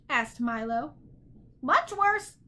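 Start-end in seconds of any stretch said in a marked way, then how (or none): 1.6-2.4 high bird voice, wistful; longing bird. A narrator's reading. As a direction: answer longingly in a high squawk. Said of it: "he said longingly".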